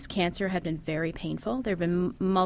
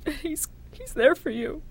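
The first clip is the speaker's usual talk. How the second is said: higher, tender soothing voice; comforting; 9 semitones higher than usual. Retold or imitated. Mother comforting her dying daughter, tender and higher. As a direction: soothe a frightened child.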